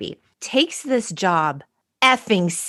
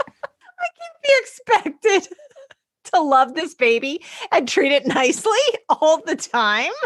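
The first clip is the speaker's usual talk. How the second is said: mock child's complaint; 7 semitones higher than usual. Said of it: "someone said".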